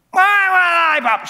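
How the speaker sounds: put-on high, fast, shouted kid's demand; child shouting. Small child demanding a lollipop, loud and high. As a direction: yell it like a bratty toddler.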